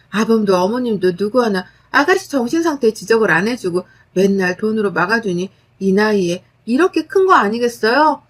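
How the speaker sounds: usual speaking voice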